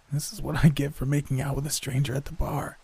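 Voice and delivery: high-pitched sickly rasp; scratchy voice. A narrator's reading. Sick roommate blaming her night out, scratchy. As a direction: complain through a raw, sore throat. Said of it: "her voice so scratchy it was painful".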